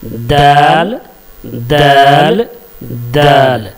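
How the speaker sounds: दाल with the tongue tip on the upper tooth roots; letter demo. Shown as a demonstration. Teacher demonstrating the letter to the class, crisp d. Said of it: "जुबान की नोक को".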